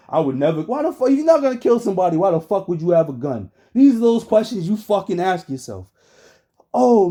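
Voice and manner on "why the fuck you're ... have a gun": ordinary talk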